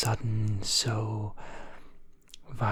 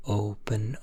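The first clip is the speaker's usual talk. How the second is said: ordinary delivery, nothing marked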